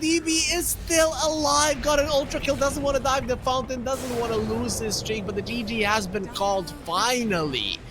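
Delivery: high, loud hype call; excited play-by-play. Acted. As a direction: call the fight at full pitch. Play-by-play of final fight.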